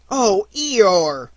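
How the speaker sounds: high, loud cry of sympathy; whole group's dismayed exclamation; exclaiming. A narrator's reading. Everybody exclaims together.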